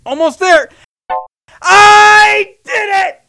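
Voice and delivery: high, slow victory yell; triumphant shout. Acted. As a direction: shout the win with joy. Triumph after straining effort.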